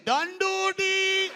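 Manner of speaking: put-on high, loud, drawn-out Indian-accented counting; mock Indian accent. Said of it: "the funniest accent is the Indian accent".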